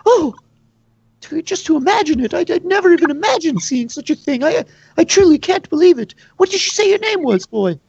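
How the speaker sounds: high, quick, marvelling tavern woman; excited barkeep. Acted. Tavern keeper greets a stranger, high and amazed. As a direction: gush over the new customer in a high voice.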